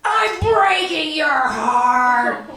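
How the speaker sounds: very slow, drawn-out dramatic threat; child's threat. Retold or imitated. Six-year-old playing Police State, slow mock threat. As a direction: stretch each word like a kid villain. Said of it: "she takes it and she goes".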